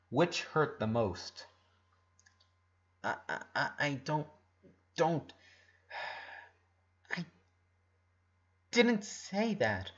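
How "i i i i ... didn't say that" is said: quiet halting stammer. Acted. Lincoln, caught off guard by Ronnie Ann, stammering quietly. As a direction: stumble over the words in shock. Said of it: "he stammered".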